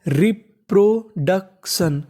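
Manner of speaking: Reproduction drawn out slowly, syllables apart; slow sounding out. Shown as a demonstration. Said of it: "तो पूरी meaning बन गई". (Reproduction धीरे-धीरे, टुकड़ों में)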